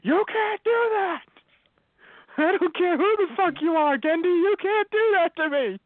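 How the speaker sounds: outraged protest. Retold or imitated. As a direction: plead at the writer in comic fury. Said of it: "I'm like".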